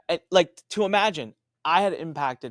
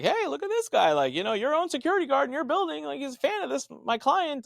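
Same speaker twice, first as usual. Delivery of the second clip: much higher, gleeful needling tone; gleeful boast; 14 semitones higher than usual. Retold or imitated. Lawyer taunting the opposing attorney about the fan guard, gleeful high voice. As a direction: rub it in their face. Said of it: "he's like".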